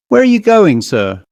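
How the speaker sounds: high sharp challenge, then clipped; stern, menacing. Acted. Head waiter stops guest in corridor, stern and menacing. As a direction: block his way with a menacing question. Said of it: "he spoke sternly, almost menacingly".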